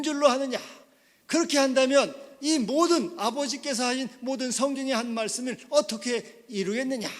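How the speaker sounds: high, loud, emphatic questioning; forceful divine rebuke. Acted. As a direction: admonish the disciple with pulpit authority. Preacher voices Jesus rebuking Peter.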